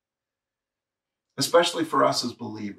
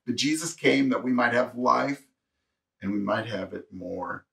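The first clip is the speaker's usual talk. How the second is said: usual speaking voice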